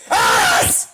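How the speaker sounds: sudden breathy shriek; angry scream. Acted. Screaming in rage at the streamer. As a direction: let out a furious scream.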